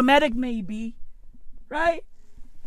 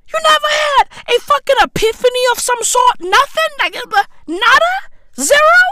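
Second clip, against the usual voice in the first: higher, fast, rising disbelief; mock-shocked high questioning of the dead man; incredulous high outcry; 8 semitones higher than usual. Real, not acted. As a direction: rattle it off, more shocked with each word. Incredulous ranting at deceased.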